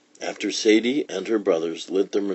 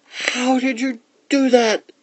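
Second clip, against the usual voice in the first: put-on very high-pitched boyish astonishment; high, marvelling little-brother voice; amazed; 4 dB louder than usual. A narrator's reading. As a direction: ask in wonder, high as a child.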